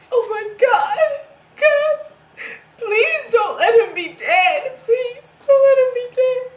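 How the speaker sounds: high, loud wail of desperation; anguished, crying shout; sobbing plea. Acted. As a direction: beg God through loud crying. Desperate plea over the body.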